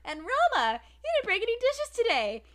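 a touch of teasing coworker; ribbing a clumsy waitress; high mock praise. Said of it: "he goes".

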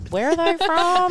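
high, loud excited question; squeal-pitched interest in a friend's outfit; hammed-up nosy admiration; eager admirer. Acted reaction to a friend's new shorts.

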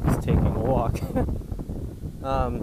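walking outside in heavy wind; wind on mic. Real, not acted. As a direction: wind noise buffeting the mic. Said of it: "I'm sorry for this wind".

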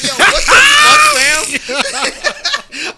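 very high, laughing yell; mock outraged shout. Young churchgoer answers the pastor, laughing outraged shout. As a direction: shriek it in hammed-up disbelief.

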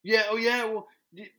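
high, loud sarcastic bragging; mock boast. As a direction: parrot the braggers back at them. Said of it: "oh, I would say".

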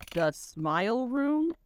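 drawn-out wondering question; puzzled question. Acted. Dolores reading the odd sign over the door, slow and puzzled. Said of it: "read Dolores".

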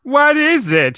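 high, loud startled cry. Acted. Reading the heroine's line, high loud cry. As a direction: shriek it out in alarm. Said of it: "she cried".

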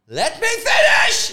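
loud, high, drawn-out shout; shouting over. Real, not acted. Speaker to friend who interrupts, loud and drawn out. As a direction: cut them off and demand the floor.

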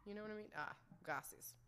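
ordinary talk

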